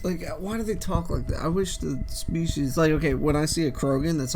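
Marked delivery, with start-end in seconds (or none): none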